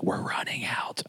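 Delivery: hushed whisper, barely audible; whispered warning. Acted. Someone tipping off the cook of a large meal, mock whispered warning. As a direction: whisper it like a secret alert to the cook.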